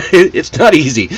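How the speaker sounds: said through a small laugh. Real, not acted. Teacher after a tricky drill, real light laugh.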